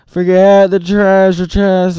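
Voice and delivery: high, loud urgent plea; urgent call. A narrator's reading. Annie calling to Jack through the storm, urgent. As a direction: call him away from the chest. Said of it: "said Annie".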